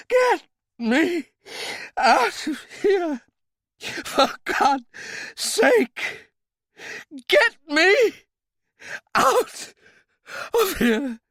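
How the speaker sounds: high, loud desperate cry; panicked pleading. Acted. Trapped man screaming to narrator, high and loud. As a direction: scream to be let out, terrified.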